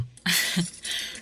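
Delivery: a quiet, airy amused laugh; soft laugh. Acted. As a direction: laugh gently at the silly worry. Mother amused by award worry. (mahinang natatawang buga ng hangin)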